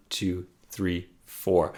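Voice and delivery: "two, three, four" dropped very soft after beat one. Shown as a demonstration. Teacher counts the bar, stressing beat one and hushing the rest. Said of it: "emphasis each time on beat one".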